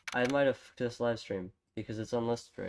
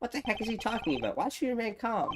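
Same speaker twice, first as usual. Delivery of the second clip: much higher incredulous voice; indignant passenger; 11 semitones higher than usual. Acted. Passenger scoffing at the call to stay calm, much higher. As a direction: scoff in disbelief.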